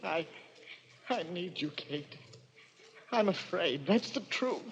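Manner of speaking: soft, fading plea; desperate hush. Acted. Quentin pleading with Kate, soft and fearful. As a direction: barely voice the need.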